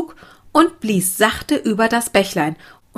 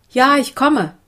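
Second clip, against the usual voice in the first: calling back; 6 semitones higher, 3 dB louder than usual. A narrator's reading. Sophia calls back to children.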